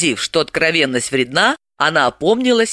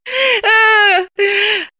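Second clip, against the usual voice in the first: loud, much higher burst of laughter; high shrill laugh; 4 dB louder than usual. Acted. Galina laughing at Dalila's question, loud high laugh. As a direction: laugh out at her friend. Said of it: "рассмеялась Галина".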